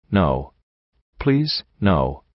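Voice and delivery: low, subdued pleading; understated tearful plea, trailing low; sobbed plea. A narrator's reading. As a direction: plead with a touch of a sob. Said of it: "P twenty-one sobbed".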